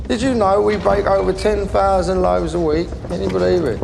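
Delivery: loud, high, showy boast. Acted. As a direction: brag to the visitor loudly. Boasting about his bakery.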